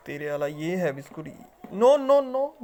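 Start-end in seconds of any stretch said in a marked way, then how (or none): 1.7-2.6 sharp high alarmed no-no-no; urgent no. Real, not acted. Owner scolding pet parrot, sharp high alarmed no. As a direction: stop the parrot right now. Stopping parrot taking biscuit.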